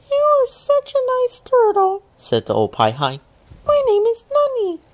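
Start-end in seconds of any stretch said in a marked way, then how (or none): 0.1-2.0 very high, small and sweet; a tiny grateful creature; squeaky thankful voice; high little Pai Hai voice. A narrator's reading. Nani's voice. 3.6-4.8 very high, small and sweet; a tiny grateful creature; squeaky thankful voice; high little Pai Hai voice. A narrator's reading. Nani's voice.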